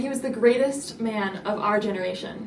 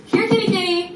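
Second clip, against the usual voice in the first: high, loud mock coaxing call; imitating a friend luring a squirrel like a cat; coaxing a pet; 9 semitones higher, 7 dB louder than usual. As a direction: call sweetly to lure the animal in. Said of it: "Julian was like".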